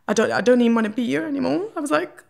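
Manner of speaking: real tears rising into the voice; starting to cry. Real, not acted. Telling how she wanted to die, starting to cry. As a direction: break into crying mid-sentence, then apologise. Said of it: "I hate crying".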